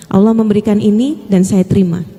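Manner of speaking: ordinary talk, nothing marked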